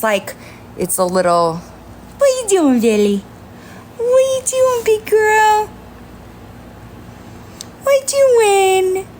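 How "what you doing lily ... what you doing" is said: high cooing voice to a kitten; squeaky sing-song pet talk. Real, not acted. Baby talk to the cat.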